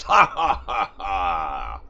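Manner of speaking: loud, high booming cackle; evil demon laugh. Acted. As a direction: cackle with a mouthful of wretch. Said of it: "bites its head off and goes".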